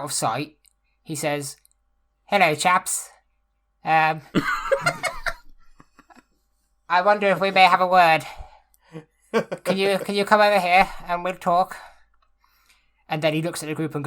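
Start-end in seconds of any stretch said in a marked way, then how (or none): 2.2-11.8 louder, higher, politely well-spoken; eloquent goblin speaker. Acted. Krill asks the goblins for a word, loud and eloquent. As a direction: address them like a courteous, eloquent gentleman. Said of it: "Krill speaks Goblin very, very well, very eloquently".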